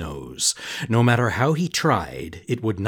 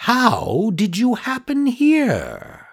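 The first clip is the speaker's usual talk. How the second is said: high, slow, sly fox; 12 semitones higher, slower than usual. Acted. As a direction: fake friendly fox. Fox asks Pinocchio.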